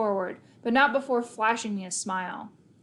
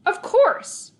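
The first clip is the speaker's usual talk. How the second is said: snarled; 12 semitones higher, 7 dB louder than usual. A narrator's reading. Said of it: "I snarled".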